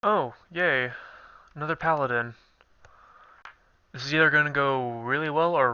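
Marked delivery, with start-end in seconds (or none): none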